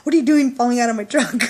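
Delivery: loud, high, half-laughing scold; incredulous scolding. Retold or imitated. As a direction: tease her for falling out. Scolding friend who fell.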